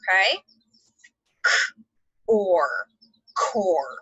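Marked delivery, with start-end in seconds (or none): 1.5-4.0 "K-", "or", then "core" blended; sounding out. Shown as a demonstration. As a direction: break the word apart then blend. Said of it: "So what do we hear?".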